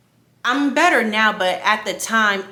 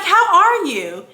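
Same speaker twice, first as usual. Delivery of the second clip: high, slow, louder imitated greeting; imitated bright check-in, pitched up; happy doctor's greeting; 7 semitones higher, 5 dB louder, slower than usual. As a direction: draw it out like a cheery doctor. Acting out the happy doctor's question.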